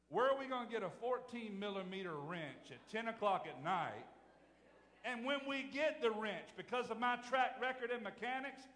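loud, high, driving sermon delivery; full-force preaching. Acted. Pastor telling the congregation his Walmart story, preaching hard. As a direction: preach it out to the back pew.

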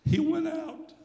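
high, choked-up preaching; overcome, halting between phrases; real tearful voice. Real, not acted. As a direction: fight back tears while telling it. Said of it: "I can't help it".

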